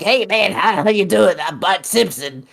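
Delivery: attempted Bart voice. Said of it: "I can't do Bart voice".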